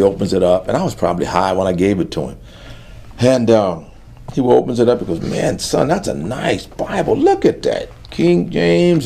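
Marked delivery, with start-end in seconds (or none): 5.2-9.1 father's voice much higher, marveling at the gift; impressed father. Retold or imitated. Father admiring the Bible his son gave him, high and marveling. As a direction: admire the Bible aloud like his delighted dad.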